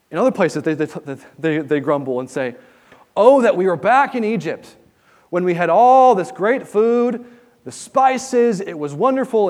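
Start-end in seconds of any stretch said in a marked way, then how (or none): 3.1-9.5 higher and louder, longing for Egypt; a nostalgic whine, raised and pushed; whiny grumbling. Retold or imitated. Israelites grumble, voiced by preacher.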